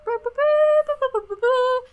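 high, loud beeping "boo boo boo" like a cartoon alarm; imitated signal. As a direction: mimic the cartoon call signal. Mimicking the cartoon's call signal.

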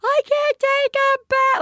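mother mimicking her daughter refusing a bath; whining child. Voicing exhausted daughter.

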